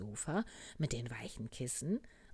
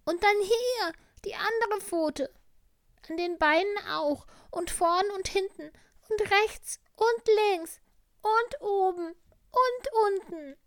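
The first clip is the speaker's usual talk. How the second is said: sick little tiger; 11 dB louder than usual. Acted. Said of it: "sagte der kleine Tiger".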